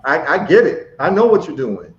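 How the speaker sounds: normal talking voice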